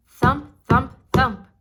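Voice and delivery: loud, heavy thump thump thump; storyteller booming out the giant's footsteps. A narrator's reading. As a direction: make each thump boom through the castle.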